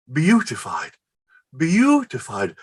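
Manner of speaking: tasting the word beautified; savoring the word. Acted. To himself, savoring her word beautified. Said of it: "A poem in a single word".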